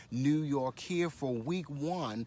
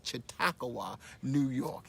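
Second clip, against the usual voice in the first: slow, drawn-out CHAUTAUQUA; said slowly. Shown as a demonstration. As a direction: stretch out each syllable of Chautauqua. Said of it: "Say it slowly".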